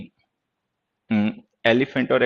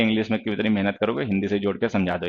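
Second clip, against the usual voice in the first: voicing his own lazy thought; lazy inner voice; 6 semitones lower, 6 dB quieter than usual. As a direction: lower and softer, lazy tempting tone. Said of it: "मेरे अंदर आलस आएगा".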